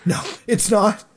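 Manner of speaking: a hammed-up angry snap; angry shout. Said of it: "I shouted angrily".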